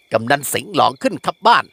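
plain reading voice

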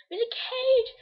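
high, loud crying wail of a prisoner; mock wail. Kid pretending to be locked up, crying out loud and high. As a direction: wail like you're locked in a cage.

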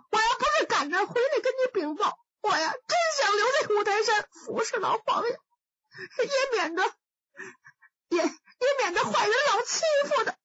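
high, tearful sobbing, stalling on the words; fake sobbing. Wei Xiaobao weeps to the emperor about the old emperor, high sobbing. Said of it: "这韦小宝越哭声音越大".

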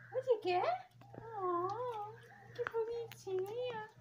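high, soft, slow cooing; soft baby talk. Acted. Owner offering her dog a treat, soft high baby talk. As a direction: melt over the cute puppy.

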